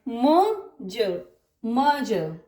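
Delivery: long "म" followed by short "ज", no r; lengthened vowel, silent R. Shown as a demonstration. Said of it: "इसके sound को लंबा कर देना है".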